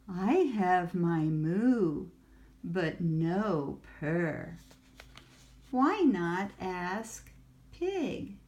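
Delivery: ordinary delivery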